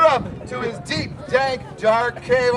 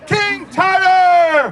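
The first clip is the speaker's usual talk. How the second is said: shout; 6 semitones higher, 6 dB louder, much slower than usual. Acted. Announcing the king loudly.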